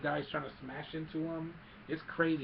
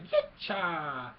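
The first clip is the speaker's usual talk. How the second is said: quick, louder little creature cry; lightly voiced Ewok; 3 semitones higher, 5 dB louder than usual. Retelling Wicket cheering on Leia, imitated Ewok cry. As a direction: give a small Ewok battle chirp. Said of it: "Wick is like".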